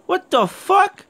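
high, loud shocked question; shaken disbelief. Real, not acted. Gamer reeling after the attack, shocked. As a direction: still rattled by the sprinting thing.

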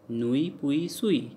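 "Nuit, puis, suis" each ending in "wee"; French "ui" sound. Shown as a demonstration. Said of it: "Sounds like ui".

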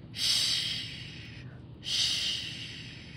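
"Sh" drawn out like surf; long, soft hushing "Sh"; SH like the ocean. Shown as a demonstration. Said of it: "Kinda sounds like the ocean".

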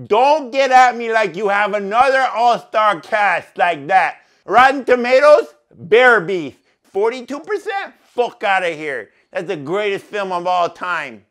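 loud mock-outraged rant. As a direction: shout him down. Ranting defense of movie.

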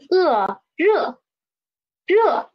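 热 begun high and dropped sharply; fourth tone. Shown as a demonstration. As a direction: let 热 fall from a high start. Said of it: "the tone is fourth tone".

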